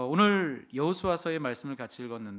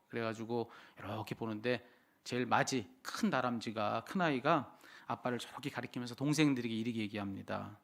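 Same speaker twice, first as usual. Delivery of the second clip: ordinary talk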